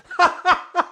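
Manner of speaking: high, loud burst of laughing; helpless laughter. Real, not acted. Vlogger cracking up at his own crude comeback, real laughter.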